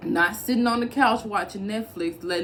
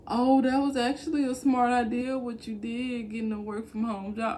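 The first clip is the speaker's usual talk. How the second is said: higher-pitched mimicry of belated praise; mock a critic coming round, pitched up; imitated former critic; 4 semitones higher than usual. Former doubters now praising her choice, higher mocking imitation.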